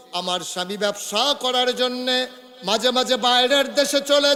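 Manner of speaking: confession building into high, loud chant; impassioned preached confession. Acted. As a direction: chant the woman's confession with rising force. (উঁচু সুরে আবেগভরা স্বীকারোক্তি)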